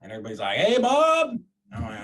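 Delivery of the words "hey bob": high, loud group hello; crowd greeting. Retold or imitated. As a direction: call it out like a whole room. Crowd greeting Bob in unison.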